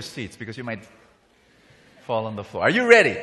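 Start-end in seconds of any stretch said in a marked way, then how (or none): 2.6-3.2 loud, high call to the crowd; rousing shout. Real, not acted. Preacher readies listeners for a shock, rousing loud call. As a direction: fire up the audience.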